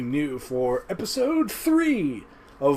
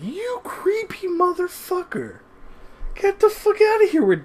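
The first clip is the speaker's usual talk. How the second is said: much higher mock shout telling the creep off; mock outraged yell. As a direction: shriek him away in hammed-up outrage. Mocking retort to creepy David.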